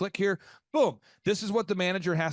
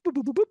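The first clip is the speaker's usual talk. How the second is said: phone ring imitation; 8 semitones higher, 5 dB louder, much faster than usual.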